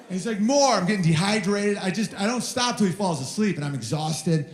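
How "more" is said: put-on much higher, louder, laughing kid's demand; laughing toddler demand. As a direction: squeal it through a laugh. Son demanding more.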